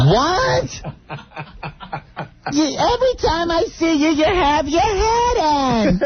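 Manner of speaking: put-on high old-lady nag; mother-in-law voice. Mother-in-law nagging Anthony about his hat, in a high nag. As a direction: needle him about the hat in a high whine.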